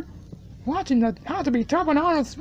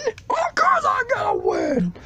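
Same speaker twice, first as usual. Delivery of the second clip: high, cocky shout of certainty; of course, shouted high and proud; confident shout; 11 semitones higher than usual. Acted. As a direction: crow it like the winner already. Boastful reply to a bet.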